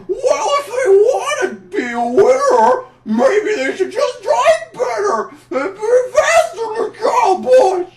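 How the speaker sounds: high, slow mocking whine; a sneering, sing-song imitation of critics; mocking whiny voice. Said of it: "everyone says it".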